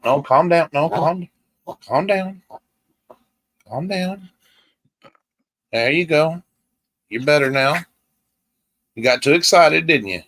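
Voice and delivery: cooing to a pet; soothing a dog. Real, not acted. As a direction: gently coax a little dog to settle. Soothing an over-excited pet chihuahua.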